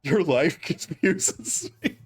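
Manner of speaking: slow quote broken by real laughter; laughing through words. Real, not acted. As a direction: struggle to get the quote out laughing. Quoting friends while cracking up.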